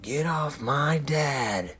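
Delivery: put-on high, drawn-out child's protest; imitating protective kids. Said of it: "they're always like".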